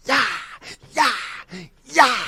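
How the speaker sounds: short, high throwing shouts; effortful yells. Acted. Torturer throwing gasoline in a man's face, effortful yells. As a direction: yell with each splash.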